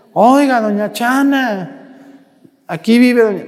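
a mild imitated call into the house; calling at the door. At the sick woman's door, a mild imitated call.

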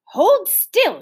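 high, loud firm command. A narrator's reading. Big sister telling the muddy child firmly to hold still. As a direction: make the child stop squirming. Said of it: "she said firmly".

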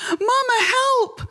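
put-on a terrified girl calling out for help; child crying for mama. A narrator's reading. Girl being beaten calls to her mother, crying high. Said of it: "I screamed".

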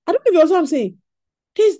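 normal talking voice

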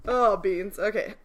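normal talking voice

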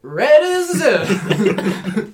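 "Ready to zip" as a slow, high, slurred yell; screaming it down. Retold or imitated. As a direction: imitate a kid hollering "Ready to zip" garbled. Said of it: "She was, like, screaming it down".

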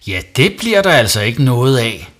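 loud, brisk, tight refusal; stern. A narrator's reading. Mum refusing Savi's plea, stern. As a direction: shut the plea down firmly. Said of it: "sagde mor stramt".

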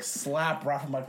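ordinary talk

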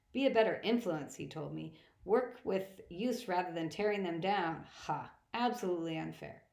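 ordinary delivery, nothing marked

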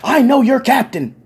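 high, loud, furious granny rant; old lady tirade. Retold or imitated. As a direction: let the old lady rip into him.

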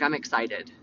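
low, matter-of-fact delivery; deadpan real voice. Shown as a demonstration. Host demonstrating how her excitement sounds, low deadpan. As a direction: drop it low, barely excited. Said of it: "that's my real voice".

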